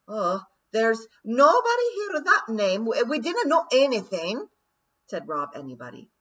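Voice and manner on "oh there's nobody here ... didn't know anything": high, loud hasty Scots denial; nervous Scottish denial. A narrator's reading. Rob Anybody facing the lawyers, loud nervous Scots denial. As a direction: fib quickly in broad Scots. Rob denies his identity.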